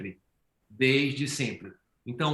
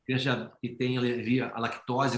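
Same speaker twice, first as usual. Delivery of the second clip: ordinary talk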